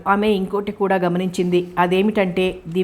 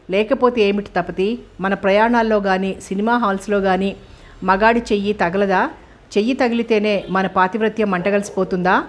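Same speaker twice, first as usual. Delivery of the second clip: usual speaking voice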